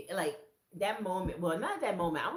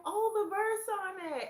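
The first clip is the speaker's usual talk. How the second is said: excited notice; 7 dB louder than usual. Retold or imitated. Friend noticing verse.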